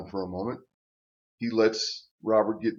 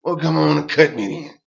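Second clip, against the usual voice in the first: louder, taunting challenge; loud dare; 4 semitones higher, 9 dB louder than usual. Retold or imitated. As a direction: dare him to swing. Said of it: "He said".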